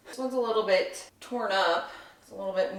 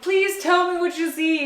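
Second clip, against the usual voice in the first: higher, pleading voice to an unseen helper; mock pleading; 8 semitones higher, 4 dB louder than usual. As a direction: beg for help in a put-on plea. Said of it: "I'm like".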